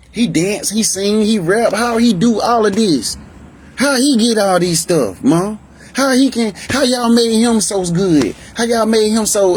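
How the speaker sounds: high-pitched, incredulous complaining; acted indignant envy. Older relatives complaining to mom about the younger one, high-pitched indignant envy. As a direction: retell their jealous protests, pitched well up.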